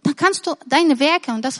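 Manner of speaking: ordinary delivery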